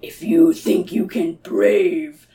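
slow, eerie whispered beast; monster whisper. Acted. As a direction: hiss it out from the dark hollow. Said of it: "Maybe he's like".

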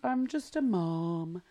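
slow, mock modest mumble; self-dismissive. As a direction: play it small and meek. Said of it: "How often do we say that?".

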